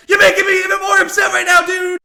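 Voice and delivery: high, loud angry yell; angry shout. Retold or imitated. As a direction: shout it, fed up. Retold escalating anger.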